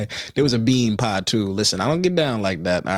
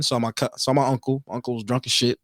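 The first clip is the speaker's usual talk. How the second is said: ordinary talk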